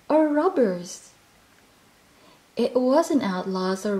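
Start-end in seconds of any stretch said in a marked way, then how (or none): 0.1-1.1 high, slightly louder frightened squeak; nervous squeak. Acted. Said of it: "he squeaked".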